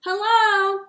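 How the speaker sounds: high, loud call again. A narrator's reading. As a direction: call out into the empty town. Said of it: "he called again".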